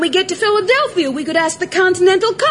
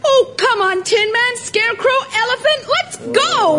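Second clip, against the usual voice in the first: rising, higher with each name; rally the troops, climbing to the end; eager rallying call; 4 semitones higher than usual. Acted. Girl urging companions on to Philadelphia, rallying.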